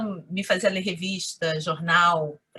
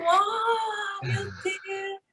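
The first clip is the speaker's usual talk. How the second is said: high, drawn-out retold gasp of wonder; mock amazement; 11 semitones higher, much slower than usual. Classmates staring at someone from the past, mock amazed. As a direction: imitate gawking amazement, pitched way up. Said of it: "Eles me olhavam assim".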